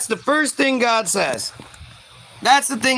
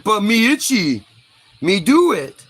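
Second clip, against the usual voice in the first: hint of a simple-minded caveman, a bit louder; lightly voiced primitive; 3 dB louder than usual. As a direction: play a mild cave-dweller. Acted primitive Eve whining.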